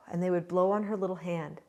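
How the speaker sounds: ordinary delivery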